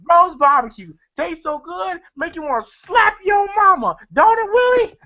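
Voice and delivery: high, climbing commercial-pitchman imitation; Uncle Elroy. Film fan imitating Uncle Elroy's barbecue commercial, high hammy sales pitch. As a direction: hawk the barbecue, pitch rising to the tagline. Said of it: "Uncle Elroy in a T V commercial saying".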